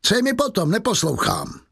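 very high, scornful outburst; angry dismissal. A narrator's reading. Javert refuses Valjean's private request, angrily dismissive. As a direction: start very high and brush the plea aside.

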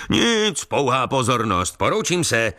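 high, breezy sing-out; exuberant exclamation. A narrator's reading. Factory owner leaves a gift and exits, exuberant. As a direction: toss it off cheerily and take your leave. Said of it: "zvolal bujaře pan továrník".

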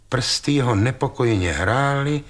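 ordinary delivery, nothing marked

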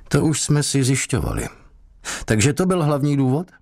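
lightly voiced suspicious interrogator. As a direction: press him like a suspicious cop. Interrogator questions the prisoner.

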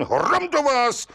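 the judge flares up at the liar; rozzlobený výkřik, výš a pomalu; angry outburst. A narrator's reading. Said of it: "rozklikl se soudce".

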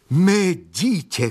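high, drawn-out cry of shock; horrified cry. A narrator's reading. Young man seeing a dog in the basket, horrified cry. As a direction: cry out slowly in horror, pitch jumping up. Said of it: "křičel mladík s vytřeštěnýma očima".

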